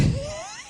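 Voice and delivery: squealing laugh at himself; a high embarrassed squeal of laughter. Real, not acted. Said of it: "that's some of the worst singing".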